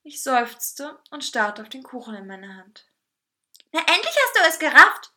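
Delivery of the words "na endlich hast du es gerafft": high, loud scolding cake voice; exasperated telling-off, pitched high. A narrator's reading. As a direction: tell them off in a high, loud voice. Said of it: "schimpfte dieser".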